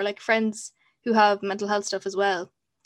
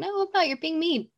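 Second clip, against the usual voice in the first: high, indignant comeback; mock-indignant high retort; 8 semitones higher than usual. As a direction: push back, offended and high. Retold retort to someone mean.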